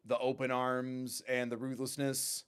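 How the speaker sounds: usual speaking voice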